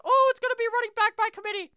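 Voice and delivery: high mocking falsetto; Matt LaFleur. Host mimics coach's running back committee line, mocking falsetto. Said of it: "what Mister Matt LaFleur says".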